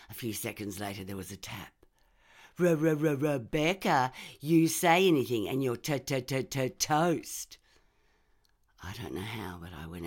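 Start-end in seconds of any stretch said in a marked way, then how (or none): 2.6-7.6 loud, higher taunting stammer; mock her stammer as a threat; stuttering mock threat. Trevor mocks her stutter, threatens.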